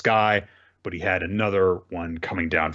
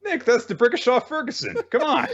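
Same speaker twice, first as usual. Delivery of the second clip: very high, laughing protest; mock exasperation. Chiding Nick over a made-up name, mock-exasperated. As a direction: pretend it is utterly obvious. Joins the joke with invented name.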